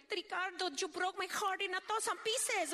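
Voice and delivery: high, dramatic telenovela sob line; hammed-up soap opera heartbreak; novela heroine crying. Imitating a beautiful telenovela actress crying over Ricardo. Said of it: "Even when they're crying".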